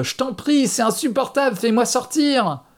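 very high, desperate begging yell; screaming plea. A narrator's reading. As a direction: beg at the top of your voice. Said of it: "hurla-t-il".

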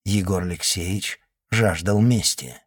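usual speaking voice

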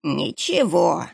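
slow wheezy croak; hoarse rasp. Acted. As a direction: rasp it out, short of breath. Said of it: "прохрипел он".

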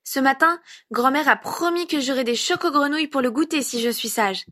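put-on excited kid sharing a promise; chattering child. A narrator's reading. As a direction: tell it like an eager small child. Child recounts a promised treat.

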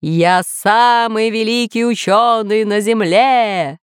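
slow, drawn-out grandiose boast; pompous overlord proclamation; boasting villain. Acted. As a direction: stretch every word in self-glory. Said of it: "громко смеялся повелитель".

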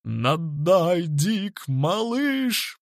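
rising, drawn-out cheer; egging on. A narrator's reading. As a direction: cheer the fighter on. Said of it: "подбодрил парня мистер Миллер". (азартно подбадривать)

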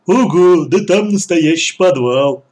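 loud, marvelling big-oaf voice; amazed lummox. A narrator's reading. Clumsy son sees the cellar, loud amazement. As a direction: marvel loudly at the discovery.

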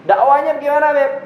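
high, loud playful ask; sudden high mock-coy question; teasing question. Real, not acted. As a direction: tease the listener.

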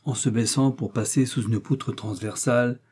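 plain reading voice

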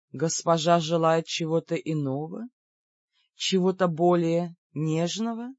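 тихий, низкий, вкрадчивый голос слуги; спроси вкрадчиво, медленно договаривая; вкрадчивый вопрос. A narrator's reading. Тень вкрадчиво спрашивает госпожу. (вкрадчиво, тихо)

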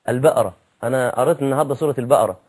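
البقرة said as البأرة, ق turned to a glottal stop; casual Cairo speech, quick; Egyptian colloquial pronunciation. Shown as a demonstration. A young Egyptian telling what he recited, in colloquial. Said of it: "ما ينفعش".

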